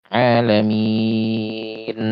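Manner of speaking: "Alamin" very slow, final n overpressed; overdone nasal. Shown as a demonstration. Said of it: "Berlebihan ya".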